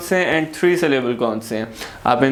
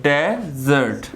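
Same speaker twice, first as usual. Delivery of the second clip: Desert slowly split as डे, jot; teacher sounding out desert in two beats; syllable by syllable; much slower than usual. Shown as a demonstration. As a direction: pause inside desert between its two syllables. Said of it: "तो क्या है ये desert का word".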